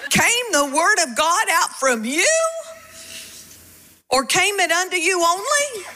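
high-pitched rhetorical questions, rising; incredulous challenge. Acted. Preacher voicing Paul's reply to the church, incredulous. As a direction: read it as Paul's disbelieving retort. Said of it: "that's what Paul was doing".

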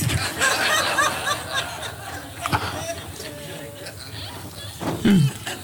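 laughing. Real, not acted. Congregation laughter.

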